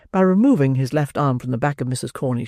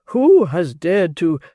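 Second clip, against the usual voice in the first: outraged beadle; 4 semitones higher, 5 dB louder, much slower than usual. Acted.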